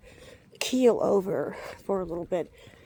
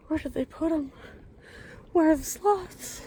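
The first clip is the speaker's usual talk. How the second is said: puzzled high question; 7 semitones higher than usual. Real, not acted. Searching for the sloths.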